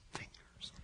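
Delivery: a hushed, awed whisper. Acted. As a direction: breathe the word out in a whisper. Dramatic pause then whisper.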